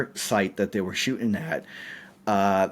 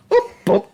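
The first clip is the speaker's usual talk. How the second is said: high, louder boop boop; imitated boop noise; 5 dB louder than usual. Storyteller mimics the crew cheerfully saying boop as the dolly breaks the roof. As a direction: toss out the boops like a carefree crew. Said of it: "they're like".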